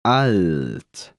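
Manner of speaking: ordinary talk, nothing marked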